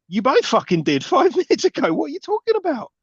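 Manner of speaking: high-pitched, rising mock outrage; exasperated disbelief. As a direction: argue back at the character, incredulous. Incredulous mock reply to character.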